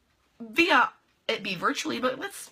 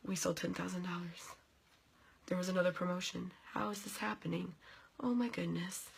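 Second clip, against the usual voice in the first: mock manic mutter; 10 dB quieter than usual. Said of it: "with my little hug myself jacket going".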